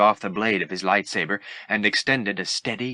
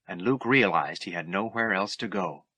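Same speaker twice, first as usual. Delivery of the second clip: usual speaking voice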